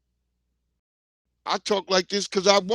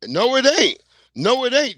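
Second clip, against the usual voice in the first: higher and louder, shouting no it ain't; loud emphatic denial; 6 semitones higher, 7 dB louder than usual. Real, not acted. Arguing with J X on stream, loud fired-up denial. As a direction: slam it down twice, fired up.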